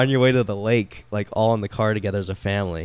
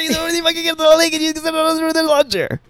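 much higher, hammed-up scolding; imitating an angry dad. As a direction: rant like a fed-up dad in the car, exaggerated. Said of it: "your dad being like".

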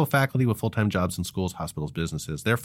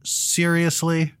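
exasperated; 10 semitones higher, 3 dB louder, much slower than usual. Real, not acted. Teased about saying Longwood.

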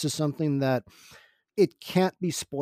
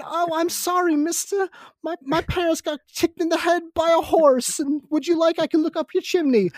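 much higher, hammed-up bad Cockney urchin; terrible Cockney accent; 13 semitones higher than usual. Podcast host mocks a Dickensian chimney sweep orphan, in a bad Cockney accent. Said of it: "That was a very terrible Cockney accent".

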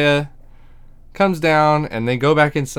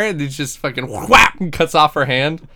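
normal talking voice

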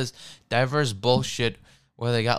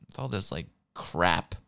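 ordinary delivery

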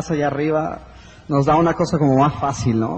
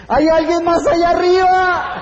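much higher, slow, anxious call upward; desperate call upward; 11 semitones higher, 4 dB louder, slower than usual. Acted. Hanging man calls up for anyone else, anxious. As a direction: call out hoping for someone else. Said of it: "y dice".

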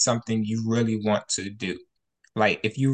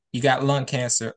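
ordinary talk

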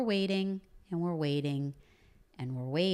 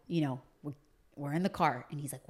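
ordinary talk, nothing marked

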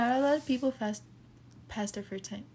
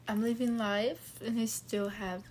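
ordinary delivery

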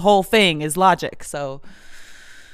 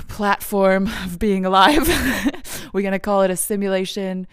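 usual speaking voice

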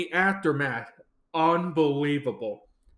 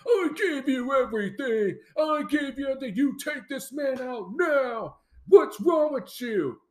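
high, indignant mock shout; imitated angry outburst; 7 semitones higher than usual. Roman berating Sami for refusing his order, mock-angry. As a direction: berate him, pitch jumping up.

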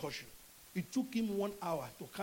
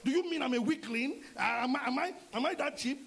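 offended protest; 6 semitones higher, 4 dB louder than usual. Retold or imitated. Man offended.

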